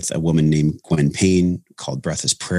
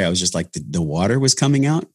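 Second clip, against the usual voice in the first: ordinary talk, nothing marked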